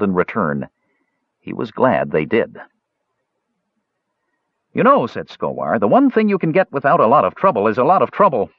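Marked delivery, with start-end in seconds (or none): 4.7-5.1 high-pitched, lively lead-in to a quip; wisecracking funny man. A narrator's reading. Skowar cracks a joke. 5.8-8.5 high-pitched, lilting quip; wisecracking funny man. A narrator's reading. Skowar cracks a joke.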